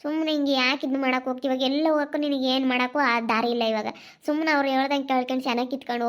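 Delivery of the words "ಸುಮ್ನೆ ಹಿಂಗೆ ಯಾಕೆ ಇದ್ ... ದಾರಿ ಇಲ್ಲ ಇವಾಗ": ordinary delivery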